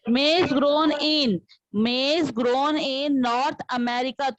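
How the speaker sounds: very slow, deliberate words; slow model reading. Shown as a demonstration. Teacher models the reading slowly for students. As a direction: read it word by word. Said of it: "उसको ध्यान से सुनो".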